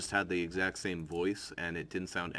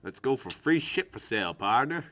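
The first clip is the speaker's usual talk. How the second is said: cowboy voice; 4 semitones higher, 5 dB louder than usual. Acted. Character voice, cowboy partner.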